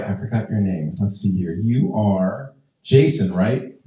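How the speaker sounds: ordinary talk, nothing marked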